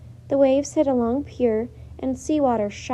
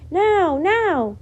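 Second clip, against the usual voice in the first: loud, higher, slow command; shouted; 6 semitones higher, 7 dB louder than usual. A narrator's reading. Boy shouting to the crowd to run uptown, loud. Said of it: "shouted Jack".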